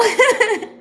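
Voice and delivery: loud, high laugh bursting out; high burst of laughter. Real, not acted. Reading her horoscope, a high burst of laughter.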